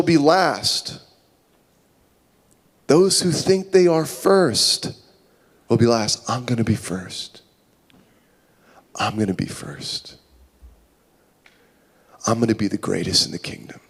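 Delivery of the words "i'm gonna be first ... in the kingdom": hushed, low-pitched self-assertion; quiet, stubborn boast under the breath; low muttering. Acted. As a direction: murmur it privately, much softer. Acted disciples secretly vying for rank.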